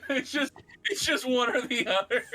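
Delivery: high-pitched, helpless laughing delivery. Real, not acted. Game master cracking up over the two-item drink menu, laughing. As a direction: laugh your way through the words.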